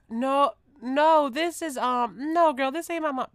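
high, loud hurried no; flustered denial. Retold or imitated. Speaker denying it's her mom to the stranger, high and flustered. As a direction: deny it quickly and brightly.

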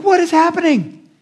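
high, shocked outcry; what on earth is going on; appalled Moses. Retold or imitated. Moses finding the people partying, high shocked cry. Moses sees the golden calf.

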